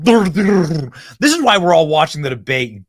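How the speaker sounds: slow, exasperated outburst; angry rant. Real, not acted. Host raging at the debate moderator, angry rant. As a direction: fume at him, dragging out the complaint. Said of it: "I done got mad".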